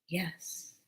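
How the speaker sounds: a single whispered yes. Retold or imitated. Answering the beings in a whisper. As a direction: barely breathe the answer.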